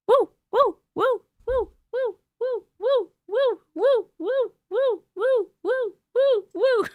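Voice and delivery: high repeated woo cheering; high woo chant. Real, not acted. Streamer cheers viewers who stayed all night with high woos.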